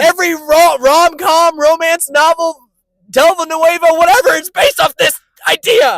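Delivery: high, loud rant building to a shout; shouting your case in exasperation; worked-up argument. Real, not acted.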